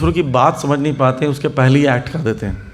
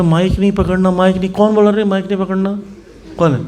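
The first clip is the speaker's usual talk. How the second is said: higher, offended comeback; mock indignant retort; 6 semitones higher than usual. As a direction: retort like a hurt devotee. Retold quarrel over the mic.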